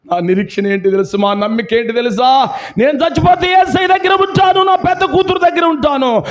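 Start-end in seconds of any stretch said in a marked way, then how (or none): 2.8-6.2 high, ringing, overjoyed declaration; joyful cry. Acted. Preacher voicing the believing mother's hope, exultant and high. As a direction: proclaim her hope with exultant joy.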